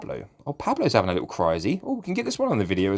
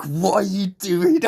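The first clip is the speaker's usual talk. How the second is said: high slow puzzled question; a hint of a baffled woman; imitating her; 9 semitones higher, 3 dB louder, slower than usual. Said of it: "She's like".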